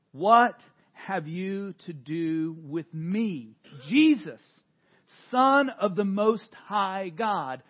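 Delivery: loud, slow crying out of the name. Acted. Preacher reading the possessed man crying out, loud and slow. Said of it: "crying out with a loud voice".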